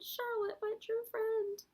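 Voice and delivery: high, slow moan. A narrator's reading. Wilbur grieving for Charlotte, high slow moan. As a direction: wail it out slowly in grief. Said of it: "he moaned".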